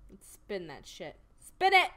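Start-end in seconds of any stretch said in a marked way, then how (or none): 1.4-1.9 high, loud cheer; excited shout. Real, not acted. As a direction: yell it out with glee. Urging game spin excitedly.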